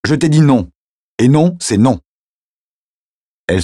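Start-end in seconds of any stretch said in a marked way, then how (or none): 0.0-2.0 higher, louder stern no; firm angry refusal. Acted. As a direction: slam the door on the idea. Emma refusing after shouting. (refus ferme et fâché, plus fort)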